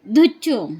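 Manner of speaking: put-on loud, playful baby talk; bouncy baby game chant. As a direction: tease the little one with a silly word. Playing with baby, cooing.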